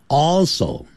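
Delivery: normal talking voice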